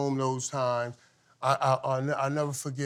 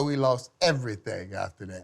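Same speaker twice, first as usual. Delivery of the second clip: usual speaking voice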